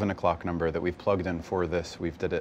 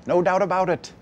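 high-pitched, emphatic recalled resolve; emphatic certainty; 10 semitones higher, 5 dB louder than usual. Retold or imitated. Actor recalling when he knew, high and emphatic. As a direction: act out being utterly sure, pitched up high. Acts out his youthful certainty.

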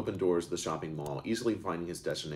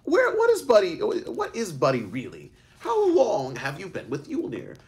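put-on much higher, louder rapid-fire questions from an overwhelmed boy; a boy firing question after question without stopping; breathless barrage of questions; 6 dB louder, slower than usual. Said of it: "Eric paused for air".

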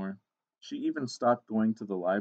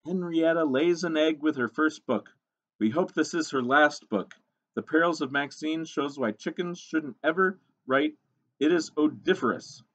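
lightly voiced disapproving book reviewer; a hint of mock-critical scorn; scornful critic; 6 semitones higher than usual. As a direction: read the bad review with raised, emphatic disdain. Reads the scathing review.

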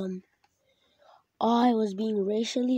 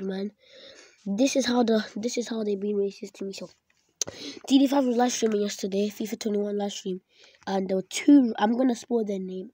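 usual speaking voice

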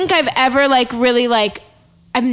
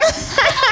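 high laugh; 11 semitones higher than usual. Real, not acted. Laughter burst.

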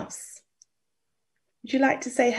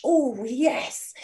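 ordinary delivery

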